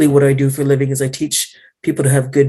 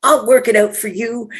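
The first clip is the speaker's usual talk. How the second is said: high, altered, eager Jaguar-sister voice; fierce Jaguar sister; 9 semitones higher than usual. Retold or imitated. Inner Jaguar sister offering to curse an abuser, high and eager. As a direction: play her wild inner avenger, up high. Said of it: "the Jaguar sister that was like".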